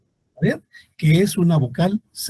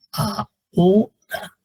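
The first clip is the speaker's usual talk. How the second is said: "ahora" stretched slowly, a-ho-ra, each vowel apart; teacher breaking the hiatus slowly; slow syllables; 5 semitones higher, much slower than usual. Shown as a demonstration. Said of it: "todas esas son hiatos".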